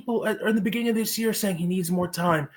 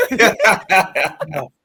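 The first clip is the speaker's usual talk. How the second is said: laughing; 2 semitones lower, 7 dB louder than usual. Real, not acted. Playful shutdown while laughing.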